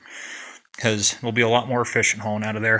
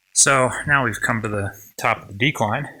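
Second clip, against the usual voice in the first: breathless after a climb; puffing between words; out of breath. Real, not acted. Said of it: "I guess I'm out of breath".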